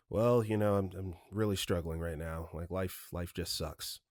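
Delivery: low, soft, dejected mumble; depressed. Retold or imitated. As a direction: imitate someone quietly admitting they are struggling. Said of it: "someone that's depressed or is having a horrible day".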